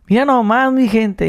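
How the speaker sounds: high, sing-song northern Mexican phrase; northern Mexican accent. Retold or imitated. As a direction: quote it with a northern Mexican lilt. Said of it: "el tonadito que dices".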